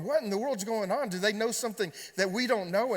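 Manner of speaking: high, loud, alarmed questions; alarmed disbelief. Retold or imitated. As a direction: retell the panic of not knowing what's wrong. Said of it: "we're both going".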